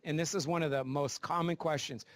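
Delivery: normal talking voice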